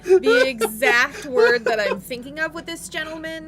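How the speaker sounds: laughing through the words. Real, not acted. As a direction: crack up while talking. Both laughing at mini.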